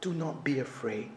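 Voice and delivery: calm a frightened hiker, very softly; soft gentle voice. Retold or imitated. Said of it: "soft Chinese voice".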